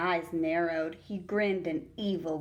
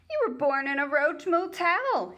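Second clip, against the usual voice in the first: higher, louder mocking sneer; sneering bully taunt; 9 semitones higher, 6 dB louder than usual. Bully sneering at a classmate, louder and higher. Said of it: "he sneered at Russell".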